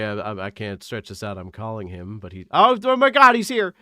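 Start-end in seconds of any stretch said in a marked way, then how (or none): 2.5-3.7 sudden jump up, loud and high, thrilled he's here; excited. Real, not acted. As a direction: burst out with joy as the guest appears. Guest finally joins the call.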